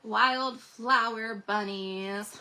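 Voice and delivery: drawn out, lingering on each word; slow savoring. Real, not acted. Crafter admiring new papers to viewers, slow and savoring. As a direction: stretch it out lovingly.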